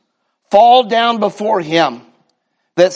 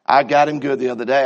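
ordinary talk

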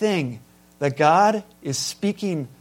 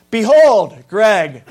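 booming mock announcement, louder; mock grand behold; 3 semitones higher, 9 dB louder, much slower than usual. Preacher mocks a friend greeting him, booming. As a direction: proclaim it like a herald, tongue in cheek. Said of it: "When someone does that".

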